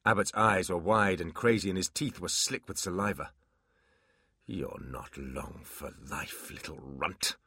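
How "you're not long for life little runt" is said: low, soft, slow threat; quiet deadly demon threat; low menace. Acted.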